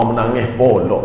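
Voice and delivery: ordinary talk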